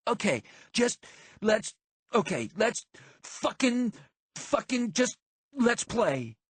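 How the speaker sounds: high, halting and exasperated; flustered urging. A narrator's reading. Marty breaks awkward quiet after insult.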